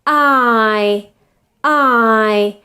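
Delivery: long "I" sliding from ah towards ih; diphthong glide. Shown as a demonstration. Teacher demonstrating how the diphthong moves, drawn-out glide. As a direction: stretch "I" slowly through both vowel positions. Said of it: "starts with an A position and goes".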